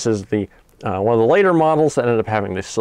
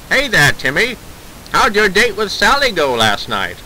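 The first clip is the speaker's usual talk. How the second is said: put-on old Hollywood mid-Atlantic voice, higher and louder; mid-Atlantic accent; 7 semitones higher, 4 dB louder than usual. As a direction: chipper 1930s radio announcer, mid-Atlantic accent. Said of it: "whip out that mid-Atlantic accent".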